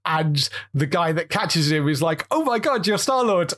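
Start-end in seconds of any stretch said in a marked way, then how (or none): 2.3-3.5 imitating a starstruck fan, higher pitch; high-pitched mock awe, retelling a film moment; impressed fan. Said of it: "is really impressed by the guy".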